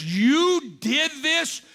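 king turning on Haman; furious accusation. Retold or imitated. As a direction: drag out each word in rising fury. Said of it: "And he was furious".

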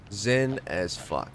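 slow calm. Acted. Repeating his zen motto.

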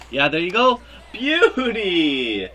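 a loud, high goal cheer; excited shout. Real, not acted. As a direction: yell it like the puck just went in. Goal scored in the game.

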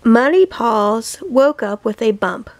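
ordinary delivery

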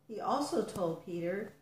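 plain reading voice

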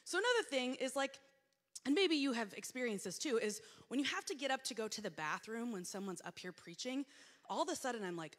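ordinary talk, nothing marked

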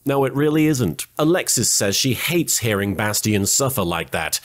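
ordinary delivery

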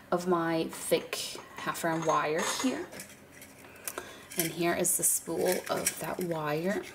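ordinary talk

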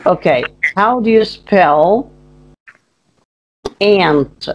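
ordinary talk, nothing marked

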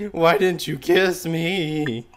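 high, drawn-out exaggerated plea; mock voice crack. Mimicking the voice crack in a friend's text, hammed-up high wail. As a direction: stretch it out high, hamming up the crack. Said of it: "That voice crack, though".

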